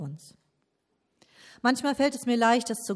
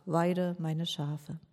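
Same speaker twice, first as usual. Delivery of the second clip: slow charge; 3 semitones lower, 4 dB quieter, much slower than usual. Acted. Jesus gives Peter a task.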